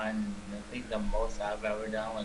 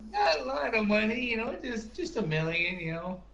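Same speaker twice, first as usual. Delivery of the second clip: sarcastic; 11 semitones higher than usual. Real, not acted. Joking about a million.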